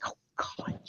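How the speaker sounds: retold dismay, whispered under the breath; a mortified whisper to herself; whispered oh God. Whispering to the transcriber's note, mortified. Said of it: "I'm like".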